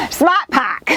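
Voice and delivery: dropped-r Boston 'Smart pack'; Boston accent. Pretend. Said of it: "my Boston accent isn't that wicked".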